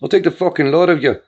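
imagined dad shouting the odds; imagined yelling. Retold or imitated. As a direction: hurl it like the furious father. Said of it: "he'd be yelling".

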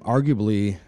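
ordinary talk, nothing marked

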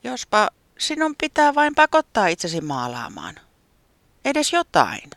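high, loud and bright-eyed weatherman pushing an idea; high eager weatherman urging a friend. Acted. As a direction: play the weatherman, keen and pushy. Säämies suggests eagerly.

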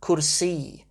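Kursi with extra effort on the ee; stress on final E. Shown as a demonstration. Teacher repeating the genitive, Kursi stressed on its final ee. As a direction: make the last vowel of Kursi stand out. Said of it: "it's the E that you're stressing".

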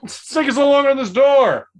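high loud complaint; shouting. Acted. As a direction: holler in frustration. Yelling over door.

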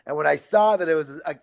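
usual speaking voice

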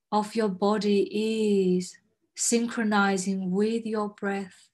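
slow, soothing meditation guide; guided meditation. Acted. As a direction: ease them into calm breathing. Leads a grounding exercise.

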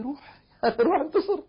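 laughing. Real, not acted. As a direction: laugh him off good-naturedly. (بضحكة ساخرة)

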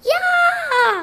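high startled shriek; frightened yell. Acted. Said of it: "yelled with fright".

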